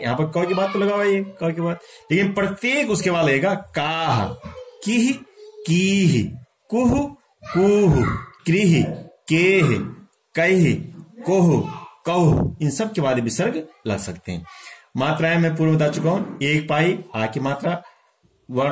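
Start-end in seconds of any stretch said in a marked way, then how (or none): 3.5-12.6 each syllable from काह to कौह closed with an echoed h; visarga ending. Shown as a demonstration. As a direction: recite कीह, कुह, कोह separately, puffing out a final h. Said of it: "इसी प्रकार जो विसर्ग है". (काह से कौह तक अंत में ह जैसा विसर्ग)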